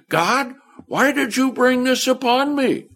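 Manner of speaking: high, whining complaint; frustrated complaint to God. Pretend. A frustrated believer complaining to God, high and pleading. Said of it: "not be frustrated and say".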